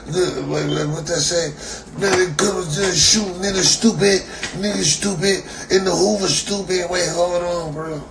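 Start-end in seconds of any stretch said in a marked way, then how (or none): 2.0-6.9 mocking taunt. Mocking the rapper he's reading about, raised taunting voice. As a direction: sneer it like a heckler.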